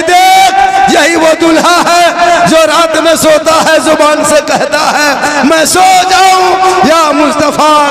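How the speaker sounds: high, loud, sustained exhortation; full-force preaching. Real, not acted. Preacher urging the crowd to save wedding money, full-force preaching. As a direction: drive each line out like a rally preacher.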